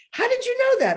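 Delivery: high squeaky imitation of an astonished son. A son baffled by his mother's knowledge, squeaky high. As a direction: play the kid, baffled and high.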